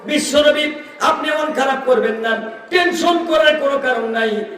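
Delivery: high chanting sermon delivery; fervent preaching. Real, not acted. Preacher relaying God's comfort to the Prophet, high fervent preaching.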